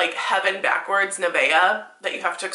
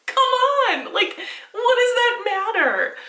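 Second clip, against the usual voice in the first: much higher, slower laughing protest; exasperated outcry; 13 semitones higher, slower than usual. Real, not acted. Arguing with the article's Shakespeare claim, exasperated. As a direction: throw up your hands.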